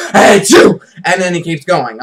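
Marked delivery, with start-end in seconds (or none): 0.1-0.8 loud imitated sneeze "shoo"; hammed-up sneeze; mock sneeze. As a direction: sneeze it out loudly. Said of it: "pauses and goes".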